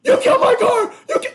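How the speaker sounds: very high, loud mock shriek; hammed-up screeching car owner; imitating outraged friend. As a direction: shriek the accusation in her voice. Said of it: "And then she's like".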